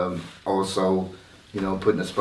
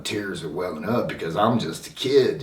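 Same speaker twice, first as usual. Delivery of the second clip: ordinary delivery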